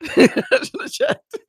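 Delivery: high, slow, laughing repeat; giggling high-pitched self-scolding; laughing squeal. Real, not acted. Repeats own regret, laughing.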